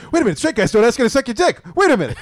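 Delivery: high, fast, loud realization with a laugh; loud high hurried realization; sudden realization. Retold or imitated.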